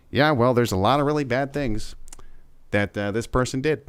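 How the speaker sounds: lightly mimicked listless politician; low energy. Politician half-heartedly accusing his opponent, mock low energy. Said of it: "Low energy".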